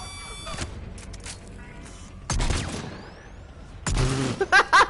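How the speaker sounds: high breathy burst of laughter. Real, not acted. Laughs after lucky kill.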